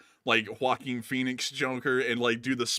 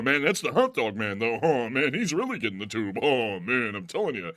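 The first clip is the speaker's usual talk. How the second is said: Rodney impression; 4 dB louder than usual. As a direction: do the no-respect comic whine. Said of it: "sounding like Rodney a little bit".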